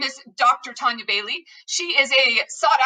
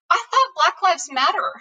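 put-on higher-pitched, bewildered young boy; confused child; 6 semitones higher than usual. Younger son questions his mom, confused. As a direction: imitate a child puzzling it out. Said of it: "all confused".

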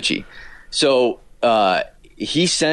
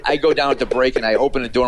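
usual speaking voice

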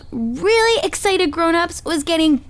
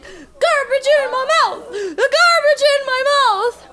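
wailing stuck grown-ups; 10 semitones higher than usual. Acted. Stuck adults wail in chorus.

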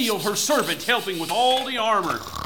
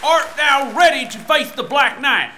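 hailing; 5 semitones higher, 4 dB louder than usual. Acted. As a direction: proclaim it to the king. Herald-like greeting.

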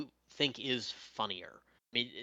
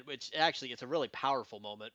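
ordinary talk, nothing marked

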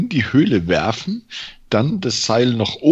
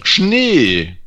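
begeisterter Ausruf; 5 semitones higher, 6 dB louder than usual. Real, not acted. Plötzliche Idee, laut gerufen.

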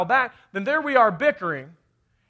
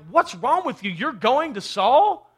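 mock accusing scold; 5 semitones higher, 5 dB louder, slower than usual. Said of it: "been like".

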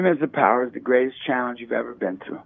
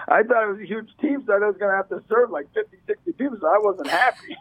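put-on 1 girl's voice; 8 semitones higher than usual. Girl quoted, laughing.

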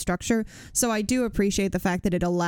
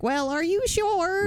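higher, drawn-out mock-officious voice; snooty administrator; 10 semitones higher, slower than usual. Administrator questioning someone asking for leave, snooty mockery. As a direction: play a fussy, doubting bureaucrat.

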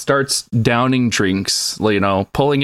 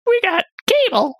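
much higher, squeaky boast; squeaky mock voice. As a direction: squeak it out proudly. High squeaky mock line.

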